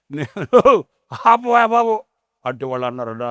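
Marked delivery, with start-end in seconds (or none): 0.1-2.0 mock wail. Sons crying out.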